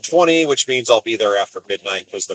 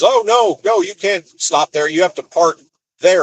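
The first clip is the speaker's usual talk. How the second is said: mock fussy yard worker; 7 semitones higher than usual. Yard worker scolding the driver.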